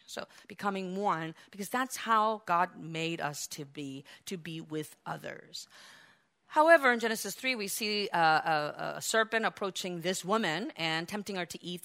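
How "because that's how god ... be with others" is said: normal talking voice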